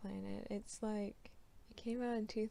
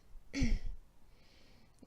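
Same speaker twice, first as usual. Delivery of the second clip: throat clear; 2 semitones higher, 6 dB louder than usual. Acted. Silver clears throat first.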